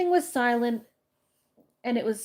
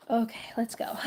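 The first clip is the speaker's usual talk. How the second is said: whisper; 3 dB quieter than usual. Real, not acted. Reader restarting after break.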